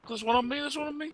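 sudden high alarmed call; startled, voice jumping up high. Real, not acted. Enemy right on him.

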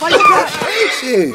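high, loud yell of hype; fired-up yell, way up high; real yell. Real, not acted. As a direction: shout it at the screen. Yelling at game.

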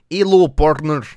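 goofy loud Russian cowboy accent. Shown as a demonstration. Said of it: "Kinda like that".

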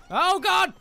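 very high, loud yelp; alarmed yell. Real, not acted. Player startled mid-fight, yelling in alarm. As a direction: cry out in sudden panic. Sudden danger in the game.

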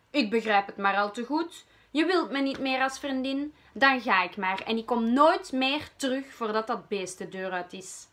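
angry shout. Acted. Wormpa storms off from her friend, angry shout. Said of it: "riep Wormpa kwaad".

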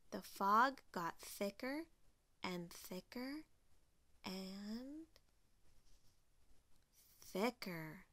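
hushed, with long pauses; building suspense. A narrator's reading. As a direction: fade down and hold back the last word. Slowing suspense as fog thickens.

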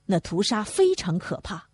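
ordinary delivery, nothing marked

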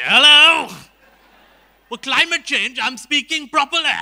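put-on loud, affected upper-crust South Bombay accent; hammed-up South Bombay English, projected loudly; proper South Bombay English. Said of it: "if you spoke English properly".

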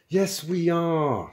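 slow, high, drawn-out insistence; insistent. A narrator's reading. Intruder contradicting his partner, insistent. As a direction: stretch it out to overrule him. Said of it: "insisted Magic Thighs".

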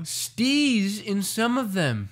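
high, slow, drawn-out mock echo. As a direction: stretch it out high to tease. Mocking friend's odd slang.

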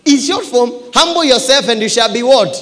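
high, loud sermon climax; full-force preaching. Real, not acted. Preacher tells church humble yourself, full-force preaching. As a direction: punch out the lesson at full voice.

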